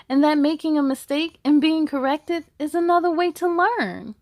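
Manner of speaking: put-on bright, steady read-aloud for kids; story narration. Reads picture book aloud.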